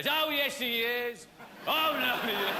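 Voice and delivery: slow, loud, high audience chant; loud mock panto heckle, drawn out; panto crowd shout. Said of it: "the old audience shouting".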